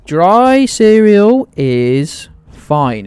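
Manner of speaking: loud, slow, hammed-up declaration; mock outrage. Streamer hamming up indignation about cereal, loud and slow. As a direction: boom it out like a mock proclamation.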